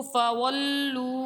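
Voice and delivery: فولو very slowly, with the waw stretched; slow drawn waw in فولو; long waw. Shown as a demonstration. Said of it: "واو مد ہے".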